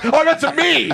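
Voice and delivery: normal talking voice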